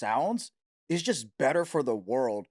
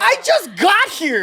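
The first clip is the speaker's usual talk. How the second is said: much higher, louder, slow whine; whiny protest; 11 dB louder, slower than usual. Pretend. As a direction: plead innocence like a flustered newcomer. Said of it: "I'm like".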